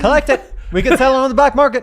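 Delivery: high, loud and fast; excited. Real, not acted. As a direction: rattle it off, thrilled with the loot. Player excited by loot.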